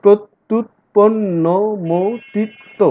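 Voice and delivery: প্রত্যুৎপন্নমতিত্ব slow, syllable by syllable; syllable split. Shown as a demonstration. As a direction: chop প্রত্যুৎপন্নমতিত্ব into syllables again. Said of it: "এভাবে করে আমাদের আসলে syllable ভাগ করতে হয়".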